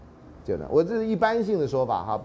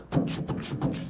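soft voiceless beatbox; beatbox sounds; 5 dB quieter than usual. Shown as a demonstration. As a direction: puff out a rap beat. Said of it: "可以改编成rap". (轻声口技节拍)

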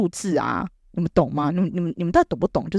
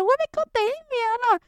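much higher, whiny tantrum voice; sulky child whine. Retold or imitated. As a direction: whine like a sulking kid. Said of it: "我就说".

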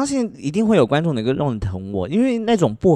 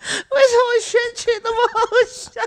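high-pitched helpless laughing words; faster than usual. Real, not acted. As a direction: squeal through the laughter. Giggling over a misread word.

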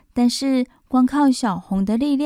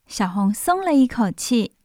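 ordinary delivery

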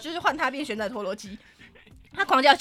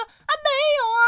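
defensive whine; 6 dB louder than usual. Retold or imitated. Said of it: "他也启动疯狗机制".

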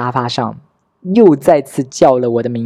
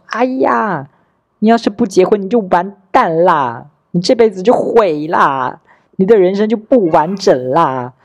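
higher-pitched, drawn-out mock lament; nagging relatives; 5 semitones higher than usual. Other relatives pressing the speaker to marry, exaggerated nagging. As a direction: mimic relatives wailing that life is ruined. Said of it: "其他人催婚就是".

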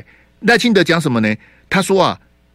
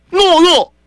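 higher, louder scornful yell; contemptuous shout; 13 semitones higher, 6 dB louder than usual. Real, not acted. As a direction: spit the word out in open contempt. Host scorns Lai's crying.

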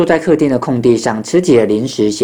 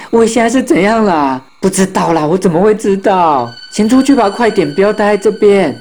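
high, frantic back-and-forth; acted flustered exclamations; panicked; 6 semitones higher than usual. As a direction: urge everyone out in alarm. Friends panic and flee.

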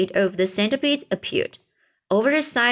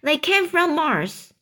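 higher-pitched storybook exclamation; a touch of told-you-so, pitched up; crying out; 6 semitones higher than usual. A narrator's reading. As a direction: insist it in a mildly raised cry. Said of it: "cried the chief of police".

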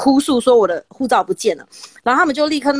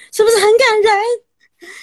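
teary mock sob; 10 semitones higher, 4 dB louder, slower than usual. Real, not acted. Moved by Taiwan's easy passport process.

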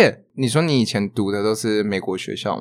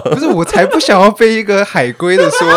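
laughing complaint; 7 semitones higher, 8 dB louder than usual. Real, not acted. Laughing complaint about accent.

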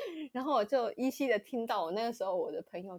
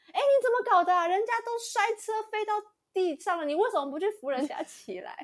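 high, loud, indignant scolding; mock the driver telling her off; imitated scolding driver; 12 semitones higher, 6 dB louder than usual. Imitating the driver yelling at the auntie, high and loud.

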